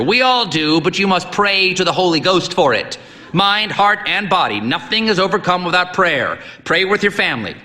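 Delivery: high, booming, lecturing; stern old priest. A narrator's reading. Old priest lecturing the boy on prayer, booming. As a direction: preach at the boy.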